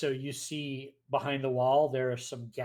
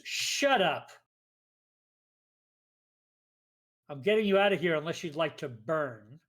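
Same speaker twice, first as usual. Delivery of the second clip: sharp, indignant snapping, a bit louder and higher; 3 semitones higher, 6 dB louder than usual. A narrator's reading. A woman snapping at the talking painting, indignant. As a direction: cut him off with indignant impatience. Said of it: "you hear this very indignant voice".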